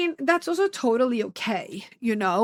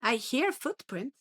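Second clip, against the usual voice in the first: ordinary talk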